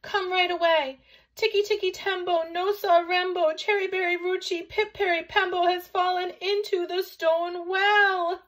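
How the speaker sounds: high, loud, rattled-off shout; urgent shouting. A narrator's reading. Chang calls the old man to the well, shouting. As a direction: shout the whole long name at full pitch. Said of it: "shouted Chang".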